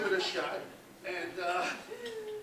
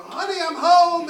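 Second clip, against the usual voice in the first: loud, high, drawn-out homecoming call; yelling up; 9 semitones higher, 11 dB louder, slower than usual. Retold or imitated. Husband calling up to his wife after work, loud yell. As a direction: call it up to someone upstairs. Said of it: "I yell up".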